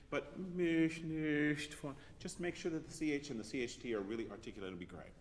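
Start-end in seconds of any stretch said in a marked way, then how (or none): 0.1-1.9 mich nicht von stretched out slowly, in an altered timbre; drawn-out diction demo. Shown as a demonstration. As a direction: draw out mich nicht von, shaping each consonant. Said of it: "it's getting mich nicht".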